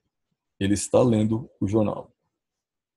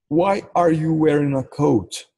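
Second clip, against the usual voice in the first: slow model sentence; 5 semitones higher, much slower than usual. Shown as a demonstration. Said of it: "Em inglês se pergunta assim, ó".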